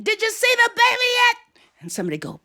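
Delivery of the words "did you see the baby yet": high-pitched excited yell; excited child shouting. Retold or imitated. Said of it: "and I was shouting".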